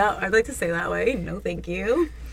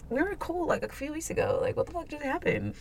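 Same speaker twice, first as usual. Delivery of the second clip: hushed aside; 6 semitones higher, 11 dB quieter than usual. Retold or imitated. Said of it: "I was like".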